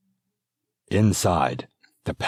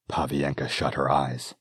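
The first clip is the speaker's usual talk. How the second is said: ordinary delivery, nothing marked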